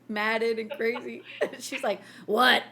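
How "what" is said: mock old porch lady, louder; lightly voiced old lady asking for a beer; a hint of a cranky old porch lady. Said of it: "old lady sitting on the front porch".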